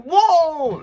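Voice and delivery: very high, loud, drawn-out exclamation; shocked whoa. Retold or imitated. Best friend seeing the single post, loud mock shock. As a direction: let out a big astonished cry.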